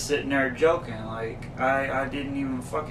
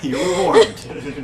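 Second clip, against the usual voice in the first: slow, giggling warning; laughing tease; 3 semitones higher, 6 dB louder, much slower than usual. Real, not acted. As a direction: tease him through laughter.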